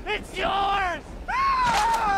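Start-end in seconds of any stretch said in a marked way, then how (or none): 1.3-2.2 high frightened yelp; scream. Acted. Captive toad shrieks in fright, high and loud. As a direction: let out a sudden shriek.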